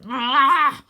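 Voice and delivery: loud, high angry cry; annoyed snarl. Acted. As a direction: snarl as the door holds. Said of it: "Croaky snarls with annoyance".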